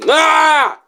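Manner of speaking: short, high yell of frustration; exasperated yell. Acted. Reviewer fed up with the wrong Buzz, exasperated yell. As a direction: let out one frustrated "Ah".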